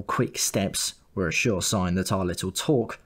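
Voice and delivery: plain reading voice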